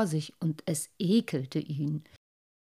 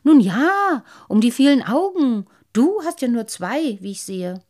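higher, louder smug fish voice; boastful fish; 4 semitones higher, 8 dB louder than usual. Acted. Lamprey bragging to the boy, loud and smug. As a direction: brag about all those eyes.

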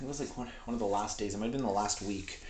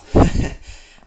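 sudden loud burst of laughter; brief, loud real laugh; short laugh; 15 dB louder than usual. Real, not acted. Laughs after admitting not fitting in.

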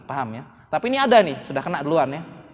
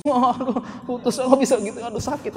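high-pitched mock heartbreak wail; hammed-up lovesick whine; mock whimpering; 7 semitones higher than usual. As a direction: imitate a friend whimpering after a breakup. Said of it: "Mehek-mehek, kenapa habis putus".

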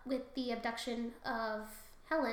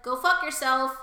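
mock-angry retort; 9 dB louder than usual. Apollo's acted retort.

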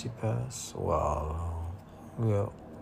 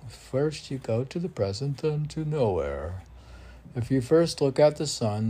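higher, sing-song verse; poem recitation; 4 semitones higher, 5 dB louder than usual. Acted. Poet reciting his poem for the podcast, sing-song. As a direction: recite with rising lilt.

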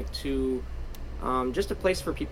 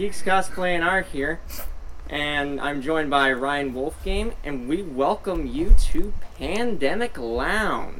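podcast intro voice; 3 semitones higher, 6 dB louder, slower than usual. Acted. Slow announcer intro.

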